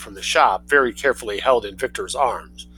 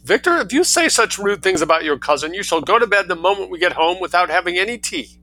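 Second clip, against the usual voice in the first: scolding mother; 7 semitones higher than usual. Acted. Mother scolding rude son.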